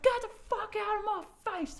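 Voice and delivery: high-pitched mock fury; retold anger. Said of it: "getting madder and madder".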